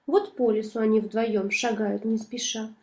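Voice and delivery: ordinary delivery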